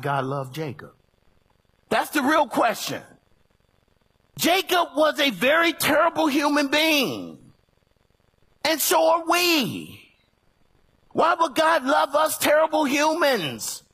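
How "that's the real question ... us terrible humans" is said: loud, high preaching shout; full-force preaching. Acted. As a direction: preach it hard to the church.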